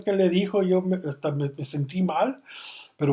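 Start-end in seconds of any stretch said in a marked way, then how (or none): none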